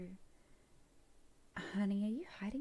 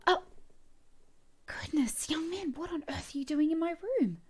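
higher and louder, caught off guard in alarm; flustered shock at a stranger in her bedroom; startled outrage; 8 semitones higher, 6 dB louder than usual. Acted. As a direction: gasp it out, shocked to find him there. Woman finds intruder in her room.

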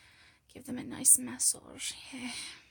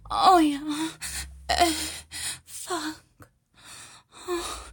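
louder gasped exclamations between panting breaths; breathless moaning; 4 semitones higher, 10 dB louder, slower than usual. Acted. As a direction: moan with mounting urgency. Acted build-up to climax.

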